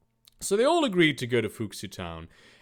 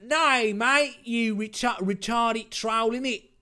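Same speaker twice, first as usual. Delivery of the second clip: put-on Cockney accent; 10 semitones higher, 3 dB louder, slower than usual. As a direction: sneer it in a thick mock Cockney. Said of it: "with a Cockney accent".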